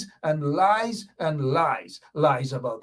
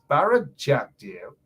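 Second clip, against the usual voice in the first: ordinary talk, nothing marked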